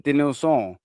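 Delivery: light French accent on "Denilson"; "Denilson" as if it were a French name. Real, not acted. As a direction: say "Denilson" Frenchly, nasal last syllable, final n dropped. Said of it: "I've done a French accent".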